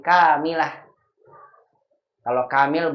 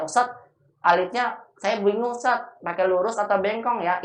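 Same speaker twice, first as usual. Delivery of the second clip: much higher, puzzled student voice; confused student; 6 semitones higher than usual. Retold or imitated. Student once asking the ustadz, higher and puzzled. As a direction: ask like a bewildered pupil. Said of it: "Dulu pernah ada yang nanya".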